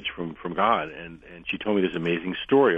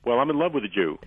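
laughing; 8 semitones higher than usual. Retold or imitated. Retelling a funny quote, laughing.

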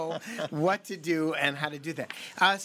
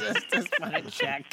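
laughing; 4 dB quieter than usual. Real, not acted. Laughing at caller's rotary phone.

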